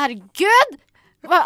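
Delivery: loud, panicked herregud; panicked exclamation. Retold or imitated. Recalling nerves before the scream scene, loud panic. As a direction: gasp it out in a panic. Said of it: "og jeg tenkte".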